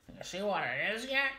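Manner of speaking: hammed-up Australian accent with swooping tune; jokey, badly faked Australian accent. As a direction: do a poor Australian impression. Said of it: "That's my bad Australian accent".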